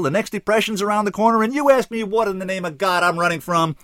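loud, high-pitched outraged rant; exploding. A narrator's reading. Bottled-up kid rails at his life, exploding. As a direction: let everything bottled up burst out. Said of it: "He exploded".

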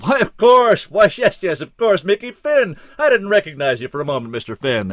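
high, loud delighted bellow. A narrator's reading. Callahan bellows his recognition of Mister Finn, high and loud. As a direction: roar it out in sudden recognition. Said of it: "he bellowed".

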